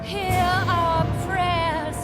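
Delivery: distant echo. Acted. Distant people praying to god.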